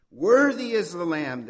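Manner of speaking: loud, proclaiming the Lamb worthy. Acted. Angels around the throne praising the Lamb, loud proclamation. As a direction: call it out like a great crowd of angels. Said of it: "they say with a loud voice".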